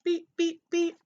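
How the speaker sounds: three short, high toots; truck beeps. A narrator's reading. Blue drives away with friends, high beeps. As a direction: toot little high horn beeps.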